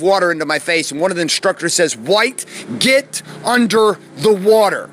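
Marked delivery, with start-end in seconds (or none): 2.0-4.9 slow, high, forceful order; stern instructor command. Retold or imitated. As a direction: drill instructor's measured, insistent order. Instructor ordering him under water.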